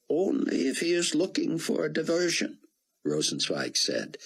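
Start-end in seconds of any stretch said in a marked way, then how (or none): 0.1-2.6 put-on high, foreign-sounding older gentleman; old Israeli scholar. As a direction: play the kindly old statesman, higher. Rosenzweig voiced by the narrator.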